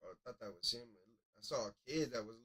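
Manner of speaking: normal talking voice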